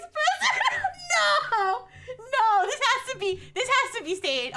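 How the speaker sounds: high, loud "no" through laughter; incredulous, laughing outcry; laughing disbelief. Real, not acted. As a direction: burst out laughing, unable to believe it.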